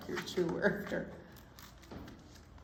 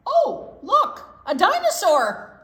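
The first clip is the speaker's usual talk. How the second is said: high, loud cry of pretend surprise; fake alarm; 12 semitones higher, 14 dB louder, slower than usual. Mouse distracting the chipmunk to grab the nut, loud fake alarm. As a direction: shout it out to fool someone.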